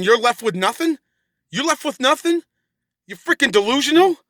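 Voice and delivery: high, rising disbelieving questions; incredulous. Retold or imitated. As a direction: throw the claim back, pitch climbing. Incredulous at ex's claim.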